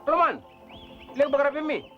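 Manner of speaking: ordinary talk, nothing marked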